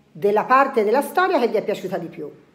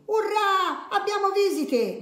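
shouted hooray; 13 semitones higher, slower than usual. A narrator's reading. Confusione greets the burglar as a visitor, shouted cheer. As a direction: cry it out with delight, very high. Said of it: "gridò Confusione".

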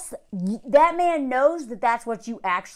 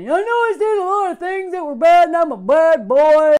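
higher and louder, hammed-up apology; mock pleading bad boy; 4 semitones higher, 7 dB louder than usual. Mocking the husband's onstage apology to her, hammed-up. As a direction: ham it up like a contrite heartthrob. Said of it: "here he goes again".

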